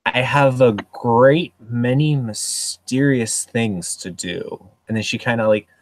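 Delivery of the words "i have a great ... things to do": slow, drawn-out mock mysterious line. Siobhan dodges Bailey's question, hammed-up mysterious. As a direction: savour each word as if hiding secrets. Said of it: "kinda thinks for a second and goes".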